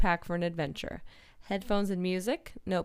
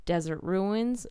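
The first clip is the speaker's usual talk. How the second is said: normal talking voice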